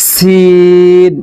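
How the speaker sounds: সিন with a ringing s and the i held very long; slow, resonant, drawn-out সিন; long buzzing sin. Shown as a demonstration. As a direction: hiss the s of সিন, then sustain the vowel. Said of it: "এটি পড়ার সময় খুব বেজে উঠবে".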